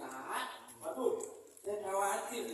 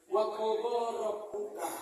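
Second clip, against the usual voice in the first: Quran recitation; 6 dB louder, slower than usual. Acted.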